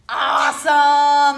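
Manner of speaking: loud, slow drawn-out cheer; excited praise. Real, not acted. Teacher praises child after technique.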